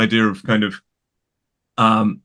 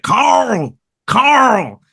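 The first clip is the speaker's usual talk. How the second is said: high, drawn-out Southern American call; mock American "Coral!" yell, much higher; Andrew Lincoln's Georgian accent; 12 semitones higher, 3 dB louder than usual. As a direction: imitate a Georgian father calling out, pitched way up. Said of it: "it sounds more like coral".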